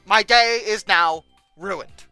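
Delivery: normal talking voice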